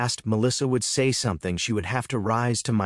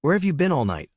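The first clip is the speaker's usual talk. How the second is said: usual speaking voice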